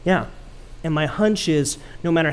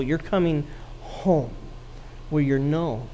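soft tender; 2 semitones lower, 5 dB quieter than usual. Acted. Hosea tells wife she's home.

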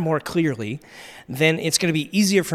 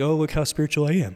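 smug, quick mock-spiritual boast; faster than usual. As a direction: show off your holiness, fast and mocking. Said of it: "quote it and be like".

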